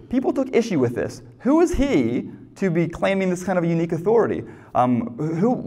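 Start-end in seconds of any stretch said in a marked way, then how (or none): none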